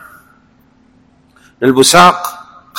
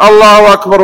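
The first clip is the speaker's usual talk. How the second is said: الله أكبر called out higher and louder; louder takbir; 8 semitones higher, 5 dB louder than usual. Shown as a demonstration. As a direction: announce الله أكبر with force. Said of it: "لو ما رفع قال". (الله أكبر بصوت مرتفع)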